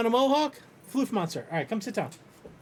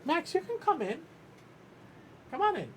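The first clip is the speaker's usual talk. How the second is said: high pet voice; 9 semitones higher, 4 dB quieter than usual. Real, not acted. Calling the dog into the room.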